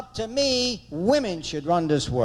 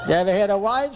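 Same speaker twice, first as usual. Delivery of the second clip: ordinary delivery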